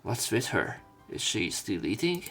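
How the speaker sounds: ordinary talk, nothing marked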